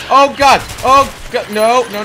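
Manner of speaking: loud, high panicked outburst; real alarm. Real, not acted. Gamer reacting to a sudden threat, alarmed shouting.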